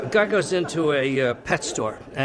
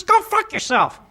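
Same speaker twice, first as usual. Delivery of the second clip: very high, loud parrot squawk; piercingly high talking-bird voice; rude parrot; 5 dB louder than usual. Acted. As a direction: swear like a defiant pet parrot. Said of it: "He says".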